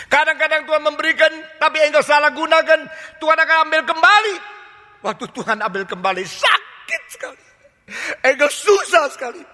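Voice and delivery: full-force revival preaching; forceful preaching. Real, not acted. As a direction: preach hard, rebuking the congregation.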